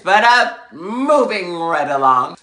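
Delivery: sudden loud, high pivot; brisk transition. Real, not acted. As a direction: cut yourself off and announce it loudly. Breaks off aside to resume story.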